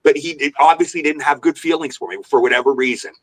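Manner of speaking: ordinary talk, nothing marked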